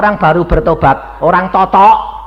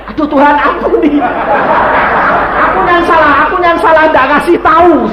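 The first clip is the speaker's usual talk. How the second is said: loud, high begging for forgiveness; hammed-up cry of self-blame; mock contrite pleading; 9 semitones higher, 5 dB louder than usual. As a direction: beg God loudly as a joke on yourself. Retells himself begging forgiveness.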